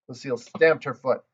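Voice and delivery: ordinary delivery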